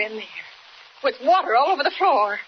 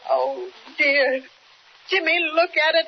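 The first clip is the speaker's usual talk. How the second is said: normal talking voice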